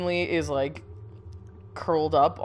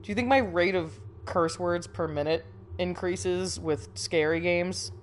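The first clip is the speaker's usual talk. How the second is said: ordinary talk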